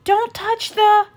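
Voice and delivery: loud, high cut-off yell; yelled warning. Acted. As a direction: yell in alarm as he falls. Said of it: "Zuma yelled".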